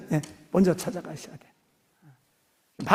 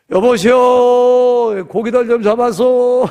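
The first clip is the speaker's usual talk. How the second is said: loud, slow, higher call across the water; calling out to fishermen; 6 semitones higher, 10 dB louder, much slower than usual. Acted. Jesus calling to fishermen on the lake, loud and slow. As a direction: hail the boat from the shore, drawn out.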